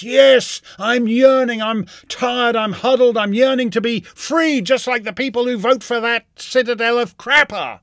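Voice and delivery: loud, high hammed-up yearning; sarcastic parody of a smug comedian; mock Colbert inner voice. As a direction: overplay the huddled masses. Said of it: "And he thinks".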